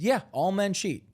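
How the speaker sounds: high, falling matter-of-fact assertion; insistent. Retold or imitated. Answering a doubter about cheating, insistent. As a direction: confirm it as an obvious fact. He defends his claim to questioner.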